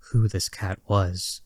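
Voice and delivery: usual speaking voice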